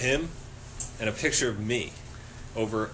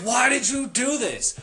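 high-pitched, incredulous demand; exasperated confrontation; 12 semitones higher, 4 dB louder than usual. Retold or imitated. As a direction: plead for an explanation, voice shooting up. Acted confrontation with roommate.

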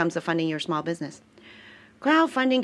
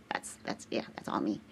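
muttered aside; 9 semitones lower, 13 dB quieter than usual. Real, not acted. Aside under her breath.

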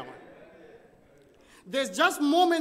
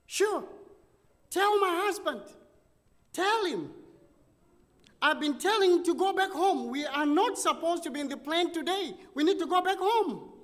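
much higher, pushy wife voice; wife jumping in; 7 semitones higher, 3 dB louder than usual. Retold or imitated. Wife jumping into the preacher's talk with her husband, high exasperated voice. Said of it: "his wife jumped in and said".

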